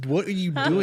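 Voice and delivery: mock exasperated yell. Friends scolding Santiago after.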